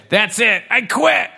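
high, huffy give-up; frustrated kitten voices. Acted. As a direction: quit in a kitten tantrum.

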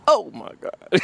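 his father's "oh my God", oh on top, God low; loud oh, then a dropped my God; imitating the father's exclamation. Shown as a demonstration. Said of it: "the "oh" is always loud, and the "my God" is really low".

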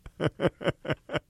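soft, short laugh; quiet amused chuckle. Real, not acted. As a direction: chuckle softly at the question. Amused by oil painting question.

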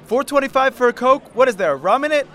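high indignant complaint; outraged fan griping about prices; complaining customer. Retold or imitated. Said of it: "people would complain about the prices".

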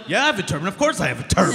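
high, eager mock agreement; overly keen, desperate to please; hammed-up eager actor; eager yes. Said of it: "But it was like".

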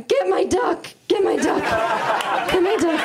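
high shout of panic for the toy duck; panicked shout. Retold or imitated. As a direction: shout it out like a terrified child. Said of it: "I just remember going".

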